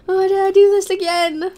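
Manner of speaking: high, loud pained cry; anguished yell. Real, not acted. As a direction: wail it out in regret. Cries out in spice pain.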